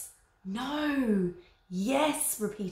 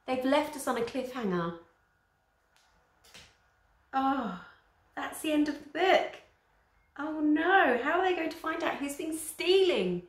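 oh no, it can't end here; dismayed surprise; 7 semitones higher than usual. Real, not acted. Parent reacting to the book's cliffhanger, higher and dismayed.

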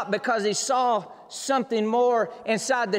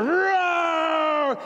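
roaring excitement; 9 semitones higher than usual. Acted. Preacher telling the lion story, slow and booming. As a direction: belt it out like the roar itself.

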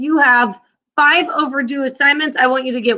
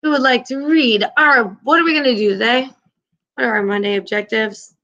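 ordinary talk, nothing marked

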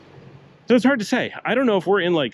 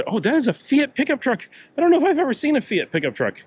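surprised; 12 semitones higher than usual. Real, not acted. Spots a surprising Fiat truck.

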